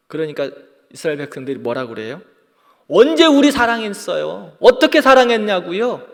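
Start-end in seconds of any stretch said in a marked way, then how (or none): 2.9-6.1 high, loud indignant challenge; defiant demanding. Retold or imitated. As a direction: throw the question back at God defiantly. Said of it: "이렇게 따지는 거예요". (높고 크게 따지듯이)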